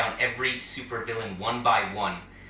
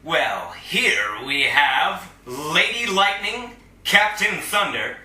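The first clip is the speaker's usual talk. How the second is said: loud, slow, higher roll call of names; a showman presenting his team; grand introductions; 5 semitones higher, 6 dB louder, slower than usual. Acted. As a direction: announce each hero like a ringmaster. Introduces each hero by name.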